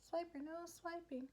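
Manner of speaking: quiet, high playful mimicry; Dora cartoon quote. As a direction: quote a kids' show in a high, small voice. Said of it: "anyone else as a kid used to watch Dora?".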